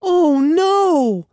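high, loud wail of dismay; dismayed cry. A narrator's reading. Andy as the dog swallows it, dismayed cry. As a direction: cry out as it's gone. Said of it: "Andy cried".

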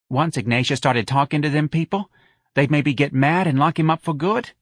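a mother passing on her son's verdict; fretful Mrs Riley. Acted. Mrs Riley doubts the movie to friends, high and fretful.

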